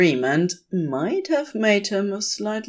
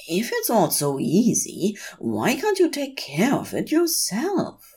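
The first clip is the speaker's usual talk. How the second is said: higher, sulky complaint; petulant grumble; 6 semitones higher than usual. A narrator's reading. Aziraphale grumbling at Crowley, mildly petulant and higher. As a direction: whine it like a put-upon angel. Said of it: "Aziraphale grumbles petulantly".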